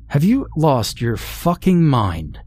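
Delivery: a hammed-up angry outburst; demanding. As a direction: confront him in disbelief. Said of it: "I asked demandingly".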